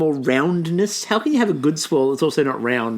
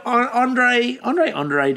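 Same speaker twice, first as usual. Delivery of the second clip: normal talking voice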